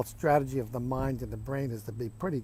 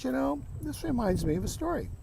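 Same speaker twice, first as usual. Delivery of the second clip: calm grandfather; 10 semitones higher than usual. Acted. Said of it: "looks at him very calmly".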